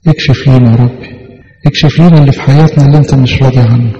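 ordinary talk

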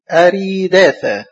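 أريداثا slow with a long ree; spelled out by syllables. Shown as a demonstration. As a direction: sound out أريداثا carefully for learners. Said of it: "الهمزة عليها فتحة".

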